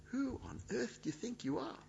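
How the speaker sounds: incredulous. Retold or imitated. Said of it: "a kind of incredulity about it".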